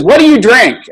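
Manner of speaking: high, loud grilling; quizzing nurse. Retold or imitated. Nurse quizzing patient about drinks, high loud. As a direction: fire the question like a strict nurse. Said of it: "she started quizzing me".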